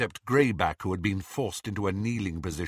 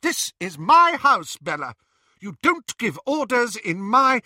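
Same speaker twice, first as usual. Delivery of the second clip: high, loud indignant protest; indignant sister; 12 semitones higher, 7 dB louder than usual. Acted. Narcissa to Bellatrix, high loud indignant protest. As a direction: stand her ground.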